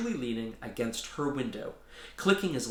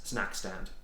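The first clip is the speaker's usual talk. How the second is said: ordinary delivery, nothing marked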